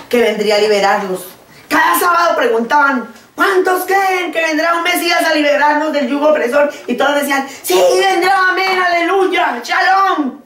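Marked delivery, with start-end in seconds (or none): 3.3-6.7 rally them like a rabbi on the Sabbath; rousing call. Retold or imitated. Acted rabbi calling to synagogue. 7.6-10.4 crowd's eager shout. Retold or imitated. Congregation answering the rabbi, high and shouting.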